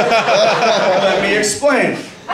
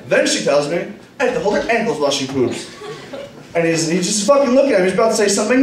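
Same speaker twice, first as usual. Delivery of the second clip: usual speaking voice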